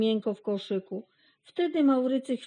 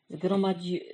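usual speaking voice

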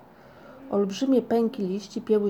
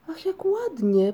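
high, slow, delighted sigh of wonder; enchanted; 9 semitones higher, 3 dB louder, slower than usual. A narrator's reading. Moomin mama wakes to flowers, enchanted and high. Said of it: "powiedziała oczarowana".

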